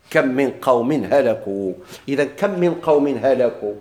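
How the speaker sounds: ordinary delivery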